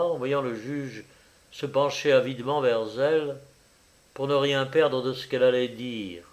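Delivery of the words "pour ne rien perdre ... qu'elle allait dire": ordinary delivery, nothing marked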